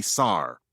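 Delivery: "sar", saw with an R on the end; added R. Shown as a demonstration. Showing the imagined missing R in saw, pronounced "sar". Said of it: "then the word saw must".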